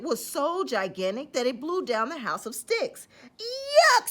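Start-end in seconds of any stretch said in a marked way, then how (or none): 3.4-4.1 high, loud disgusted squeal; squealed yuck. Acted. Pigs sprayed by the sneeze, squealing in disgust. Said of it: "squealed the little pigs".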